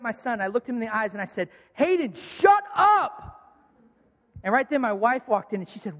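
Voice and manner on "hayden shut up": put-on sudden loud, high, slow yell at a crying baby; furious shout. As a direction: snap at the screaming son, full force. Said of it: "I'm so frustrated because he will not be quiet".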